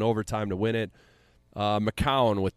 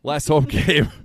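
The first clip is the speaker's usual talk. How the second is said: laughing through the words; 6 semitones higher, 9 dB louder than usual. Real, not acted. Drunk podcaster laughing through the words. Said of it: "I'm drunk".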